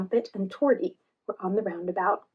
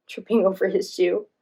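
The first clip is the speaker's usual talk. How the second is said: ordinary delivery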